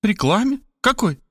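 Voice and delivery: high-pitched amazed questions; astonished mother, voice jumping up. A narrator's reading. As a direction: echo it in high disbelief. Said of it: "Мать изумилась".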